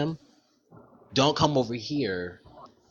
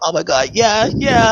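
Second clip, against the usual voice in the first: excited yelling; 9 semitones higher, 9 dB louder than usual. Real, not acted. Excited agreement.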